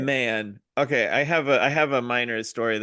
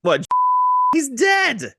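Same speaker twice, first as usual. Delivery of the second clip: high, loud outburst; pitched way up, exasperated; incredulous protest; 7 dB louder, slower than usual. Real, not acted. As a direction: blurt it out loudly in protest. Protesting co-host's worry about naming.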